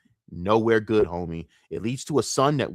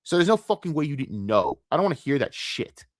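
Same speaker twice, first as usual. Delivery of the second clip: usual speaking voice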